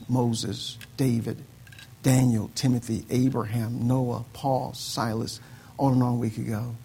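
loud, driving pulpit delivery; rising to full force, preaching to the church. Acted. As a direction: preach it out to the whole congregation.

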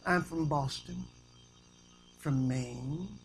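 soft, low, self-satisfied uppity talk; hushed and prim, nose in the air; uppity New Englander. Acted. New Englander claiming to do everything right, quiet uppity talk. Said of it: "they have that uppity talk".

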